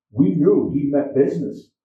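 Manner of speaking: ordinary delivery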